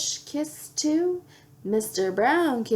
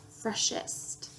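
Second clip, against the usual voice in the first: whispered; 10 dB quieter than usual. Acted. Tongue twister build.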